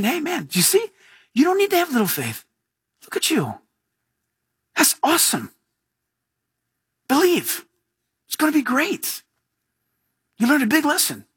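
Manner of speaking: high, bright encouraging voice of Jesus. Acted. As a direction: cheer Peter on like a proud friend.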